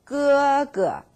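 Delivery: 哥哥 said high and level; held first tone. Shown as a demonstration. Teacher models 哥哥, first tone held high. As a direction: keep 哥哥 high and flat. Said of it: "First tone again".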